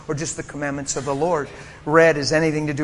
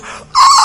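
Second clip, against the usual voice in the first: very high loud ah; imitated gasp; 11 dB louder than usual. Preacher imitating the mother's amazed gasp, very high and loud. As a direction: mimic a mom's stunned joyful gasp.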